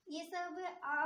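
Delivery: ordinary talk, nothing marked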